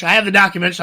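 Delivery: mock Alex Jones. Host repeating the Alex Jones bit, mock. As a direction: repeat the claim as a mock rant. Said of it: "I need papers to shuffle".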